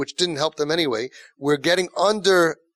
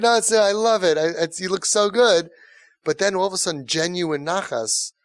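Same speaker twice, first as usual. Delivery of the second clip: ordinary delivery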